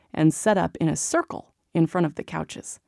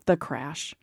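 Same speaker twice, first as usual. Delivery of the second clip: usual speaking voice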